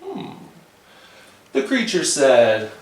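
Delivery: ordinary delivery, nothing marked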